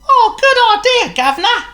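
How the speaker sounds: very high, loud, laughing monkey voice; chirpy monkey agreeing with a giggle; squeaky cheeky monkey; Chatter the monkey. Acted.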